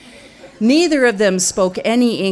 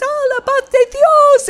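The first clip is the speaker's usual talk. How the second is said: put-on very high, loud old woman's wail; a shrieking old granny; wailing grandmother; 8 dB louder than usual. Grandmother panicking.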